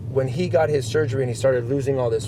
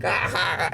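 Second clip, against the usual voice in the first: higher-pitched mock laugh at someone; imitated mocking laugh; 5 semitones higher than usual. Friend laughing at him after the weigh-off, imitated laugh. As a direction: act out a friend's taunting laughter. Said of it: "he was looking at me and laughing".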